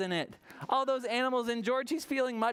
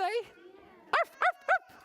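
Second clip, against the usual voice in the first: loud, very high yaps; dog bark; 3 dB louder, much slower than usual. Acted. Puppy George proving he can bark, loud high yaps. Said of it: "like a dog".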